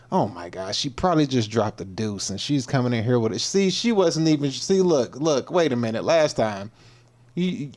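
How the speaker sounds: ordinary delivery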